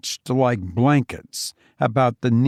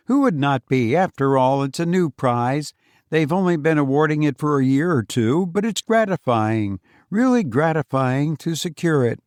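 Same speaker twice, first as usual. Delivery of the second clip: a higher, self-satisfied voice; Foster's voice; 4 semitones higher than usual. Acted. Boastful visitor lectures his old friend, higher and self-satisfied. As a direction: patronize him kindly while praising yourself, a bit higher. Foster brags about the prize.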